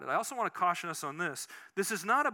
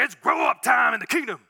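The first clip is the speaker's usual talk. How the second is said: military shout; 5 semitones higher, 10 dB louder than usual. Retold or imitated. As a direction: bark it like a drill sergeant from the pulpit. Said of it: "he had a loud voice".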